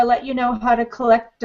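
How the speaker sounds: ordinary talk, nothing marked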